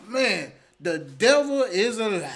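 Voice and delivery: slow, booming church-style rebuke; loud denouncing. Real, not acted. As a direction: denounce him loudly, stretching the words. Denouncing friend in banter.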